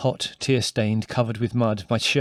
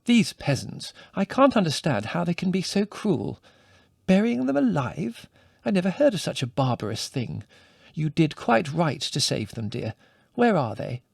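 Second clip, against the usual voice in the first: higher, mildly indignant motherly voice; a touch of shocked indignation; 6 semitones higher than usual. A narrator's reading. Mother hearing of buried puppies, mildly indignant. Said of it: "she exclaimed indignantly".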